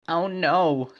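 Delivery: high, loud "oh no"; exclaimed. Acted. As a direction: cry out in alarm.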